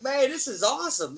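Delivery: high, thrilled thought; a lifted, delighted aside; excited inner voice. Retold or imitated. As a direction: gush to yourself. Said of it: "I'm like".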